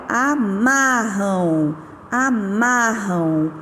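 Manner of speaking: slow "amarram" with the MA syllable drawn out; stressed MA. Shown as a demonstration. Teacher to class, slowly stressing the tonic syllable. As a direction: stretch "amarram" slowly, leaning on MA. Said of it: "qual que é a sílaba tônica?".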